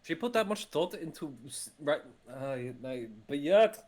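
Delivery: loud, high, stumbling exasperation; exasperated complaint. Acted. Player complains at how little the careful note says, exasperated. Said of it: "complaining about this, a little exasperated".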